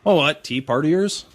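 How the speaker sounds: ordinary talk, nothing marked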